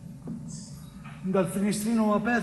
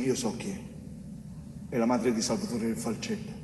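ordinary delivery

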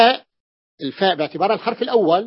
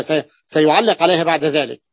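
ordinary talk, nothing marked

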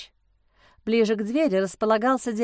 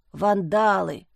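emphatic verdict; 2 semitones lower, slower than usual. A narrator's reading. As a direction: pronounce it like a final verdict. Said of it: "припечатал человечек". (припечатать, как приговор)

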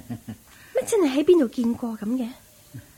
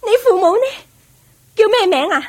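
higher, louder pressing questions; urgent questioning; 7 semitones higher, 6 dB louder, faster than usual. Acted. Young lady pressing the maid for her parents' names, urgent. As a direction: demand the answer now.

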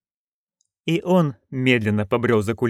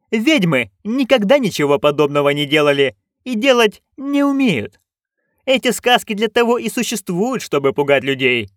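imperious duchess; 8 semitones higher than usual. Acted. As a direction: lay down the law as the duchess. Duchess lectures guard.

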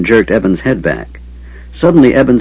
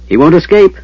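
higher, louder shout; 7 semitones higher, 4 dB louder than usual. A narrator's reading. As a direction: shout after the fleeing man. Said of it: "Sturm shouted".

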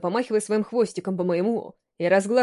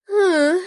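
high moan; 9 semitones higher, 6 dB louder than usual. Acted. Female character moans in sex scene.

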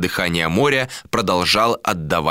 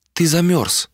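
higher female character, lightly done; lightly voiced woman; 9 semitones higher than usual. A narrator's reading. Woman touches his back, lightly voiced higher concern. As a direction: voice her a little higher, asking.

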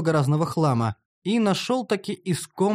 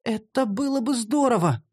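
higher female character voice, musing; mildly dreamy, pitched up; wistful drawl; 7 semitones higher than usual. A narrator's reading. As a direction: draw it out hopefully. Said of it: "протянула Шантри".

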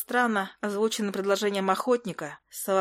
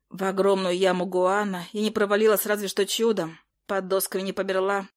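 ordinary delivery

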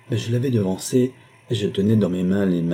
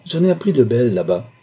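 raised, louder, agitated voice; quivering, vibrant outburst; 4 semitones higher, 6 dB louder than usual. A narrator's reading. Numa back with gossip for his stunned family, agitated. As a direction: burst out with scandalous news. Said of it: "Son verbe à la fois tremblé et vibrant".

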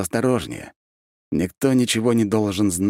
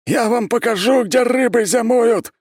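very high, loud raging shout; enraged roar; 6 dB louder than usual. A narrator's reading. Mad ex-emperor threatens everyone from his cell, roaring. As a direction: roar the threat, drawing out the last words. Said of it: "ревел Константин".

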